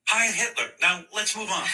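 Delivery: high, loud mock salute cry. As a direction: blurt it as a parody. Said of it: "mock Kyrie".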